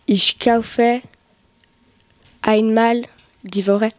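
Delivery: ordinary talk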